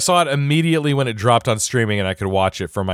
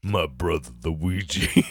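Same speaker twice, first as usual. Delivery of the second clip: deep, slow, soft action-hero voice; low, solemn, and drawn out; Vin Diesel impression; 6 semitones lower, 6 dB quieter, slower than usual. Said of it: "Vin Diesel".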